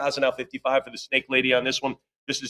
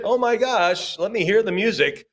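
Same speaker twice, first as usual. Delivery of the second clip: much higher, eager and delighted; real excitement; 7 semitones higher, slower than usual. Real, not acted. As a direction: gush over the find. Excited about the poster.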